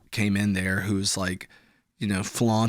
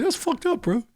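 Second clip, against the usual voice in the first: high pitched whiny bro; mock offended bro. Friend reacting to the show, high mock whine. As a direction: squeak out the complaint.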